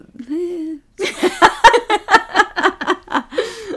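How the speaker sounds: long high embarrassed laugh; loud sheepish laugh. Real, not acted. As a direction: laugh off not knowing. Embarrassed at not knowing.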